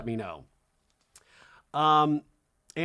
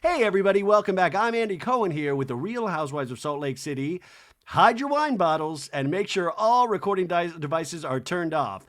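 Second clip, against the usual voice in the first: raised, projected show-opener imitation; TV host welcome; 2 semitones higher than usual. Host welcoming viewers to the reunion, mock presenter voice. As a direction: announce it like a host back from break. Acting host's show opening.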